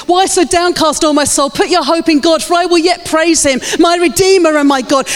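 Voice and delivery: high, fervent declamation of a psalm; impassioned preacher quoting David; rousing self-exhortation. Retold or imitated. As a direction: stir your own soul to praise. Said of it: "David shouted to himself every day".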